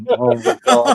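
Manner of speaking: high laughing exclamation. Real, not acted. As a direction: crack up while saying it.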